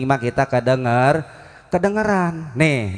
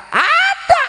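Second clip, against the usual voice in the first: very high, squealed reply; comic high-pitched exclamation; emphatic answer. Acted. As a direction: leap right up in pitch. Emphatic answer to own question.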